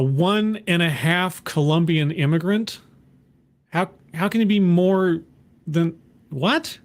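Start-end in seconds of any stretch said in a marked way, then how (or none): none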